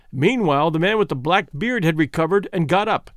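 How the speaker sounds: ordinary delivery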